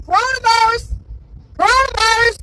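high, loud imitation of panicked people; mock hollering. Imagined homeowner freaking out at someone pulling into the yard, mock hollering. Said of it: "they're gonna freak out and start hollering".

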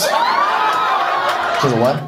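high, slow, stunned "what"; shocked disbelief. Real, not acted. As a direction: squeal it in disbelief. Shocked at Paltrow news.

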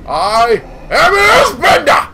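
loud triumphant boast; triumphant shout. Pretend. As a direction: bellow it proudly. Shouted grand declaration.

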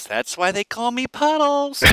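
put-on high, slow, goofy girl voice; stupid-faced quip. Drunk girl excusing peeing on couch, high goofy drawl. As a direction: draw it out high and silly. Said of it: "the way she looked at me and said this".

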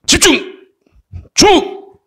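high, sudden military command; army general. Acted. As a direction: bark it like a general calling troops to attention. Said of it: "장군이".

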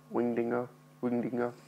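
"winged dingo" said with the ng sound slurred into the d, "dingo" ending in a soft er; speech impediment demo. Shown as a demonstration. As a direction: say "winged dingo" twice, softly, showing the slurred ng. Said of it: "weird speech impediment".

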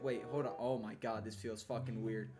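normal talking voice

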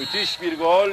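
loud, high praise of the goal; excited commentator. Real, not acted. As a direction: still buzzing after the goal. Celebrating the goal.

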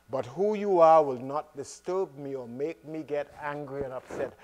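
normal talking voice

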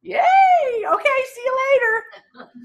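high-pitched hammed-up cheer; mock over-excited answer. Coach plays agent answering how's the market, exaggerated glee. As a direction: gush it high, like a cartoonishly thrilled agent.

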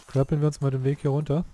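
ordinary talk, nothing marked

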